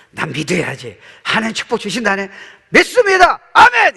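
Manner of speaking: rising from firm to a high shouted amen; building amen response. Acted. Preacher acting a believer's response, building to shouted amen. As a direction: swell into a loud high amen. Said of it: "이렇게 나오는 거예요".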